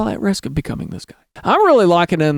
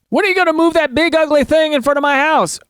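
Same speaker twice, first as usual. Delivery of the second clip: high-pitched hammed-up complaint; mock indignant neighbor; 9 semitones higher than usual. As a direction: demand it like a fussy neighbor.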